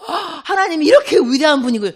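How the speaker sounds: gasp, then loud, high amazement; acted awestruck outburst; awed realization. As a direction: marvel aloud as if it just hit you. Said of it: "크게 깨달아서".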